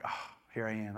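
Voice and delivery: low, hushed giving in; retold surrender, dropped low and very soft; quiet surrender. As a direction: say it softly, as if yielding at last. Voicing the moment of surrender.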